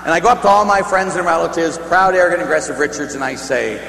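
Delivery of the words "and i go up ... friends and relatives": ordinary delivery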